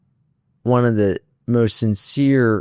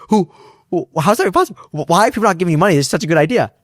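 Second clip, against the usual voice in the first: stammering, fast and higher with disbelief; sputtering amazed investor, a bit louder; retold excited disbelief; 6 semitones higher, 3 dB louder, faster than usual. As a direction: play the investor who can't believe it.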